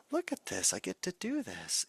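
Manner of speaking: high-pitched, excited discovery; delighted wonder. Acted. Teacher voicing the shift in thinking, delighted wonder. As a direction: marvel at the chance you have been given. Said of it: "This shift in thinking of".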